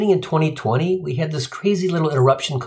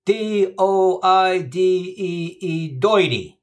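slow, thick Queens spelling; New York accent; 4 semitones higher, much slower than usual. Retold or imitated. Arnie spells at the bee, slow Queens accent. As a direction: drawl it out like a Queens kid. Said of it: "Arnie says".

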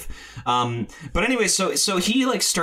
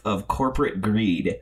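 usual speaking voice

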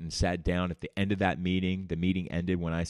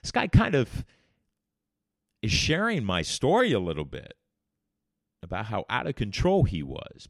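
amazed; 3 semitones higher, 5 dB louder than usual. Real, not acted. Amazed recognition.

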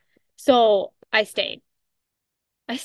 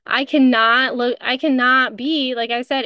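ordinary delivery

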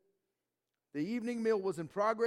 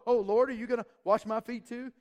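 higher, louder, fussing like a customer; hammed-up picky guest asking for service; mock fussy request; 4 semitones higher, 7 dB louder than usual. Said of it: "He's not like that".

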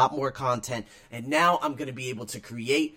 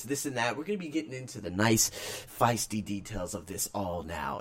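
usual speaking voice

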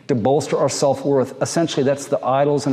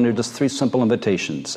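ordinary talk

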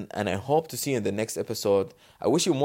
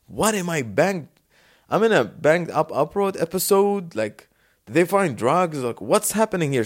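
mock panic; 7 semitones higher, 4 dB louder than usual. Retold panic during police search.

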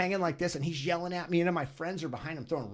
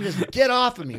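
louder, higher yell of get off me; retold yell; 8 semitones higher, 9 dB louder than usual. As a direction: yell it at the guy pinning you down. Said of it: "I'm, like, yelling at him".

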